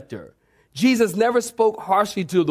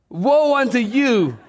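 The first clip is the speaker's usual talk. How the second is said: higher, drawn-out mock denunciation; mock harsh rebuke; 6 semitones higher, slower than usual. Preacher replays the rebuke Jesus never gave, mock harsh. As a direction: play up a scolding "woe". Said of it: "He never spoke harshly to her".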